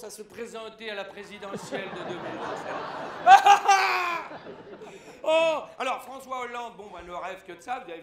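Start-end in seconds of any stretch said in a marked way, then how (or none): 2.8-5.2 loud, high burst of laughter. Real, not acted. Rire après l'annonce Bayrou. (grand éclat de rire)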